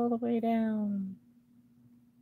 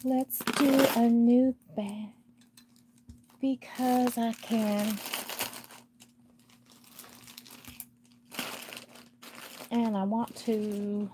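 slow, louder, teasing lilt; playful sing-song; 3 semitones higher, 4 dB louder, slower than usual. Pretend. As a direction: stretch it out like a cheeky child. Playful defiance opening new bag.

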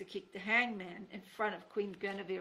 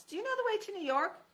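put-on much higher, eager childlike ask; hopeful question; 13 semitones higher, 4 dB louder than usual. Boy asking the king.

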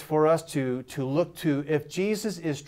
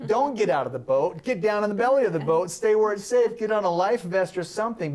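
higher, louder, hurried worrying; mock panic; 6 semitones higher, 3 dB louder than usual. A nervous bystander shouting advice to Peter, mock panic. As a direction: fuss at Peter like a nervous friend.

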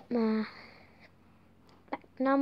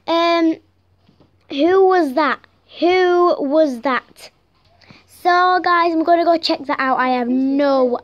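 loud, raised startled questioning; 3 semitones higher, 6 dB louder than usual. Pretend. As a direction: demand to know, alarmed. Startled by an unknown voice.

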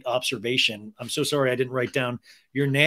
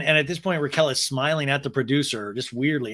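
ordinary delivery

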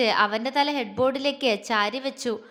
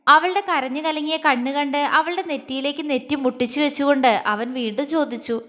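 ordinary delivery

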